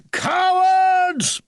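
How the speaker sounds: very high, loud defiant yell; angry shout. A narrator's reading. Mister Edgar shouting at the growlers outside, a defiant yell. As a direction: shake your fist and shout it. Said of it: "he shouted".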